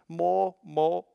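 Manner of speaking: a lightly voiced toddler; toddler asking. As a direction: do the little granddaughter begging. Toddler signing for more.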